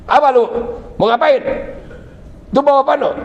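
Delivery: mother-in-law challenging the husband at the door, mildly stern. Retold or imitated.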